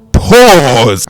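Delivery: loud, high call to stop; joking shout. Real, not acted. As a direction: yell it to stop yourself mid-sentence. Jokingly stops himself.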